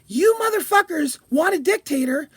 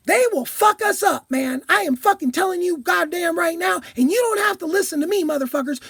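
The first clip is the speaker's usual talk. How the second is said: ordinary talk, nothing marked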